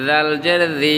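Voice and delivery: 'জাল' and 'জি' like voiced th; tongue-tip Dhal. Shown as a demonstration. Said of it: "জিহ্বার আগা থেকে".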